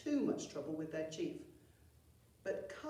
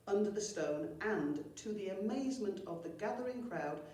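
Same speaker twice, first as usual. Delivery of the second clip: usual speaking voice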